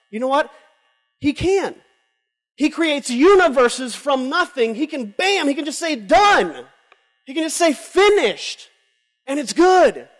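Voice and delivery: rising, loud preacher's build; fervent preaching. Acted. Preacher declaring God's power to the crowd, rising fervor. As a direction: preach it with growing fire.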